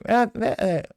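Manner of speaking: ordinary talk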